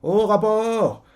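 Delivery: calling out. Retold or imitated. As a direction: call the report out slowly. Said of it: "Quelqu'un parle, il fait".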